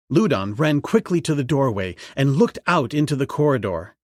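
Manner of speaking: ordinary delivery, nothing marked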